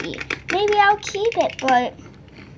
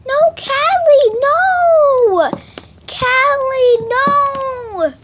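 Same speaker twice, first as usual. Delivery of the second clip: calling out; 9 semitones higher, 7 dB louder, much slower than usual. Pretend. Calling runaway dog.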